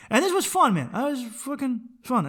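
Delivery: high, loud cheerful outburst; excited. Real, not acted. Wrapping up the episode for his listeners, excited and loud. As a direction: burst out happily.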